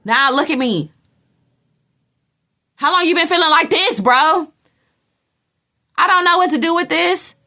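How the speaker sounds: high, loud, disbelieving rant; incredulous outburst. Retold or imitated. To a friend who suddenly confessed feelings, high loud disbelief. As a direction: voice jumps way up in shocked disbelief at the friend.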